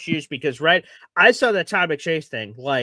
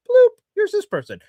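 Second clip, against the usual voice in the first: a high, louder bloop sound, then the words; call-line bloop; 5 dB louder than usual. Retold or imitated. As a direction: pop it out like an incoming call alert. Imitating the call line's sound.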